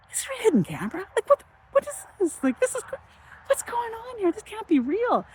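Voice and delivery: half-laughing, can't believe it's real; giddy disbelief. Retold or imitated. Suspecting a hidden camera at the casino, high giddy disbelief.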